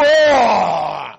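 loud, low menacing bellow; a big low scare-them shout; intimidating roar. Acted. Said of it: "trying to assume something intimidating".

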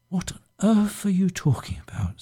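an incredulous whisper. Acted. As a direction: hush it, barely voiced. Marcia reacts in the quarrel.

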